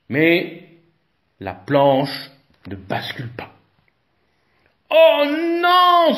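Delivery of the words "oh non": high, loud wail of dismay; a crowd groaning in dismay; loud dismayed cry; lamenting. A narrator's reading. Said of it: "se lamente la foule".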